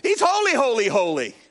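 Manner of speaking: high, ringing shout of praise; angels shouting holy. Retold or imitated. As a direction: shout it in awe, overwhelmed. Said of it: "Let's do one more".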